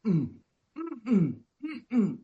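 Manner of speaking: high, closed-mouth refusal hums; mother's refusing hum. Retold or imitated. Son retells mother refusing food with mm-mm hums. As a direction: imitate a dying mother refusing the dropper. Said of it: "my mom's going".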